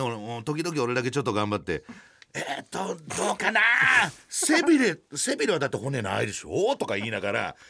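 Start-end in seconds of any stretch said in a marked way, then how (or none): 2.3-6.8 higher, eager mock answering; trying to liven things up. Lone tourist answering the instructor, mock eager and higher. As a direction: pump up the room with a keen answer. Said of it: "盛り上げようとしたけど".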